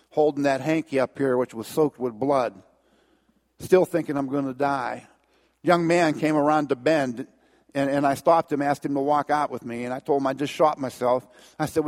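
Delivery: usual speaking voice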